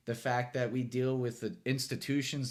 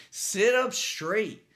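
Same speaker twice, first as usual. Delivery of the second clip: slow emphatic command; 8 semitones higher, 5 dB louder, much slower than usual. Real, not acted. Pep talk to viewers.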